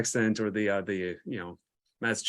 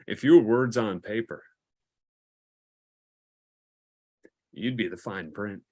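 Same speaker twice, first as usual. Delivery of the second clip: deliberate pickup line; 6 dB louder than usual. Pretend. Reads pickup line to make them laugh.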